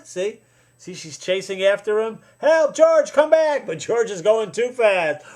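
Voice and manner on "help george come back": loud, high cry for help; panicked nurse calling. Acted. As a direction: yell after the runaway, hammed up. Nurse chasing George, voiced.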